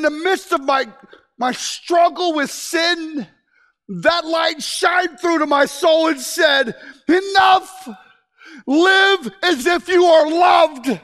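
slow, loud shouted preaching climax; full-force preaching. Acted. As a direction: thunder it out slowly to the congregation.

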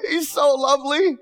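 put-on elderly missionary beaming at the chapel crowd; joyful missionary. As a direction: ask it smiling, overflowing with joy. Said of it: "she started smiling".

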